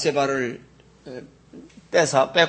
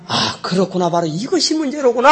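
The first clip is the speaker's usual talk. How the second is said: high, loud burst of realization; sudden realization; 9 semitones higher, 6 dB louder than usual. Acted. Pastor acting out a believer's realization, high and loud. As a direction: voice the dawning insight, pitched well up. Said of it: "하고 깨달은 상태가".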